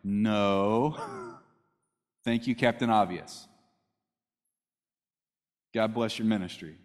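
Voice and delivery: sarcastic Peter. Retold or imitated. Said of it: "Peter's like".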